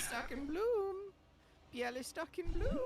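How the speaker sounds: announcer voice. Pretend. Reading the game message aloud, high sing-song announcer.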